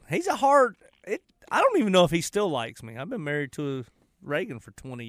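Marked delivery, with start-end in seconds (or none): none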